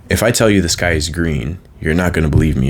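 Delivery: ordinary talk, nothing marked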